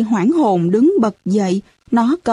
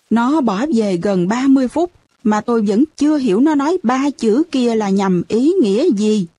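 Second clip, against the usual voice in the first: ordinary delivery, nothing marked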